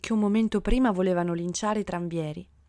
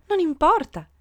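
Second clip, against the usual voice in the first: higher, louder dismissal; breezy declaration; 9 semitones higher, 5 dB louder than usual. A narrator's reading. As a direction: declare it brightly, brushing off the objection. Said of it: "dichiarò la signora".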